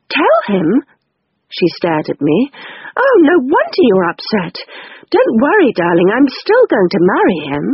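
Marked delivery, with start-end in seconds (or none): 0.1-0.8 higher, startled question; Rose's voice. Acted. Rose surprised. 3.0-7.6 put-on higher, soothing older sister; calm her down, darling; Rose's voice. Rose reassuring sister.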